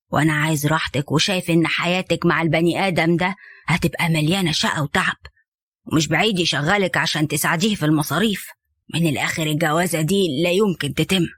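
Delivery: low, firm father's voice laying down the law; stern father. Acted. Father warning his daughter off Safwan, low and stern. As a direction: play the disapproving dad, deep and unbending.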